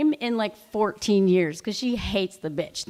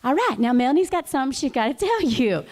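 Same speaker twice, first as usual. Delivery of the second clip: southern mom; 3 semitones higher, 3 dB louder than usual. Retold or imitated. Mom passing the floor in a Southern accent. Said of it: "does the southern".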